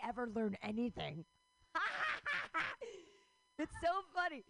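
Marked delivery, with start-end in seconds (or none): 1.7-3.0 a shrieking laugh, much higher; cackling at her own joke; high burst of laughter. Real, not acted. Cracking up at the Zoom generation, shrieking laugh.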